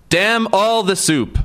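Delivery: high, slow theatrical bellow. A narrator's reading. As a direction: drag out the curse like a stage tantrum. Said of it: "Anton bellowed".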